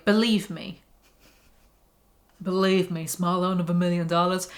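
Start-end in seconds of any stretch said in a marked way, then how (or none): 2.4-4.5 slightly lower, altered voice; awful celebrity impression. Reader riffing on believe me, badly done impression. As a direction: badly imitate a boastful public figure. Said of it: "sorry, that was an awful impression".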